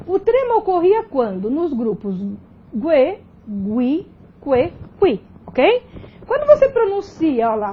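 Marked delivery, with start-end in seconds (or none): 2.7-5.2 gue, gui, que, qui as gwe, gwi, kwe, kwi; U pronounced. Shown as a demonstration. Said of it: "você tem pronúncia do U".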